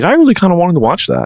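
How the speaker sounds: high-pitched imitation of the roommate; mock roommate voice. Storyteller imitating his roommate, mock high voice. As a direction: quote him in a silly high voice.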